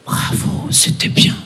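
whispered, restrained praise; disapproving lady. Acted. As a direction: whisper faint congratulations, grudgingly. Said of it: "une dame qui vient me voir".

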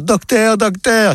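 mock tearful Sarah. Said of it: "en pleurant".

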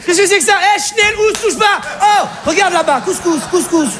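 a mother alarmed at a stranger; mother startled by her daughter's fiancé, shrill and high; panicked Alsatian mother. Acted.

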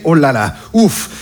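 usual speaking voice

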